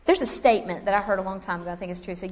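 usual speaking voice

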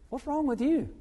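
high-pitched baffled question; puzzled coworker. Retold or imitated. Coworker asks the tearful new convert what's wrong, puzzled. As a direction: ask it high, imitating a bewildered friend.

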